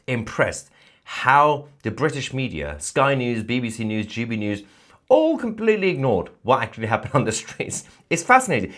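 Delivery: drip with sarcasm, pretend to be amazed; sarcastic. Real, not acted. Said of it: "I'm saying this sarcastically".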